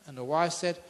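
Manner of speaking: normal talking voice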